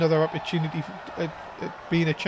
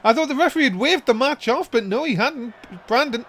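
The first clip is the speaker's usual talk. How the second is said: ordinary talk, nothing marked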